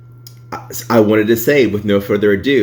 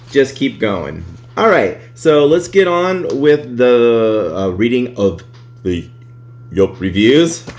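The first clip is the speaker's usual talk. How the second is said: ordinary delivery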